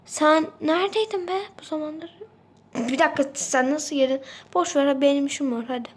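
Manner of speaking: usual speaking voice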